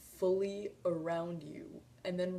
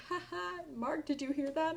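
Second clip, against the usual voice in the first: laughing; 10 semitones higher, 4 dB louder than usual. Retold or imitated. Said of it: "laughed and was like".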